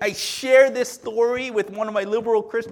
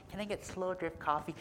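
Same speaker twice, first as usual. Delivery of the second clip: much softer, mock snooty customer voice; mock coffee snob; 11 dB quieter than usual. Pastor mocking an LA coffee customer, softer mock snob voice. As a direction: tease the coffee snob lightly.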